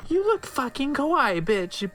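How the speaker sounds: put-on high, gushing teen girl voice; gushing valley girl. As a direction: squeal the compliment like a fangirl. Gushing friend's compliment.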